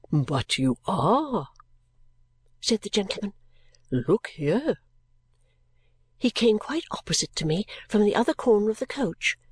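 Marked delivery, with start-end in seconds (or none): 0.1-1.5 low, very slow character voice; eccentric gentleman. Acted. 3.9-4.8 drawn-out character voice with swooping tune; eccentric gentleman. Acted.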